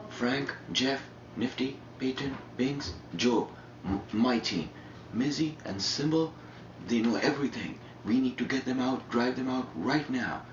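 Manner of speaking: high-pitched imitation of an agitated rant; mock furious. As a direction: reel it off like someone boiling over. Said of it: "furious, fucking boiling".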